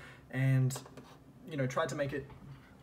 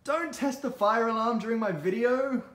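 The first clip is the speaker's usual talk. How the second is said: angry shout; 8 semitones higher, 9 dB louder than usual. Real, not acted. Fire alarm test interrupts recording.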